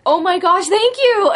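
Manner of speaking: retold excited gratitude, pitch climbing; excited thanks. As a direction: gush like you just got the job. Retold thanks for job offer.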